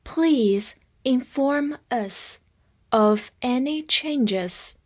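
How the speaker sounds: very slow, in careful separated chunks; slow read-along model. Shown as a demonstration. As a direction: draw each word out for learners to follow. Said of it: "跟我慢读一遍".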